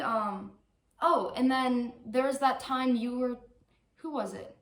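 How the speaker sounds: usual speaking voice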